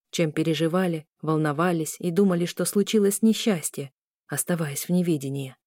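plain reading voice

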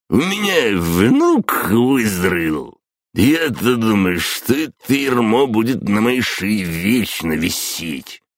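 put-on slow, drawn-out drunken old man. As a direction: ramble like a tipsy grandpa. Пьяный дед оправдывается. (пьяный дед, медленно и тягуче)

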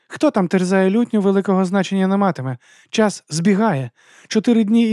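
high, cool woman mercenary voice; Vitari's voice. Acted. Vitari briefing Cosca on the street musicians, high and dry.

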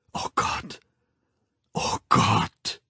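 voiceless, breathed-out dismay; horrified whisper. A narrator's reading. The Savage remembering last night, horrified whisper. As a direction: whisper it, stronger the second time.

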